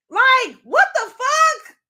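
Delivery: slow, high, loud what the fuck; incredulous shout. Real, not acted. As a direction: stretch it out in disbelief. Outraged at the father.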